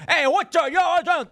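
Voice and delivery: mock Biden outburst. Biden yelping at Trump's muttered insult, high and loud. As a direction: play Biden yelping back in alarm. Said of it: "And Biden will go".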